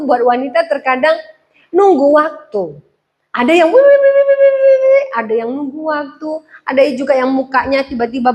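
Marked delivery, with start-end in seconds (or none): 3.3-5.1 rising mock huff. Woman's anger building up.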